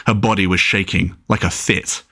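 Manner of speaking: usual speaking voice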